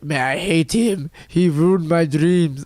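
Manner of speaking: slow, grudging imitation of the rival; mock-bitter, slow rival voice; slow mock-sulky rival. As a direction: drag it out like a sore loser. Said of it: "he's like".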